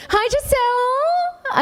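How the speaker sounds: long, high, drawn-out call; sing-song hello. Real, not acted. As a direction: sing the greeting slowly up high across the room. Calling out to friend in audience.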